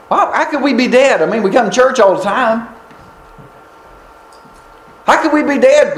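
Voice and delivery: high-pitched, protesting disbelief; acted astonishment at the accusation; surprised churchgoers. Church members answering that they come every Sunday, high incredulous protest. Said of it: "they would have been surprised".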